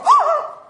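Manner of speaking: high, loud mock-horrified "ah"; shocked gasp. Speaker showing a counselor how not to react, mock shock. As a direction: shriek in exaggerated shock. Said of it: "you can't go".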